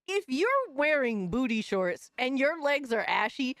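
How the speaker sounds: ordinary talk, nothing marked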